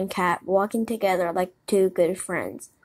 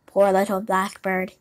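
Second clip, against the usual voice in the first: plain reading voice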